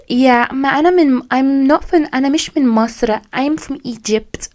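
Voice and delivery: high, posh Arabic-English mix; Egyptian girl mixing English. Retold or imitated. Mocking Cairo girls who mix English into Arabic, high posh voice. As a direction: mimic a trendy Cairo girl showing off London. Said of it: "you have Masr and you have Egypt".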